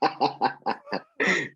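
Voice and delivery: high laugh at own joke; real, high-pitched chuckling; amused laugh. Real, not acted. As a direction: laugh after a wry family quip. Joke about the father-in-law.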